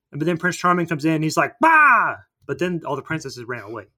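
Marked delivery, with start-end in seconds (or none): 1.6-2.2 loud, high "Bah!" to scare; jump-scare shout, pitched up; villain's sudden yell. Retold or imitated. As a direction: burst in with a mock roar. Villain bursting in, retold.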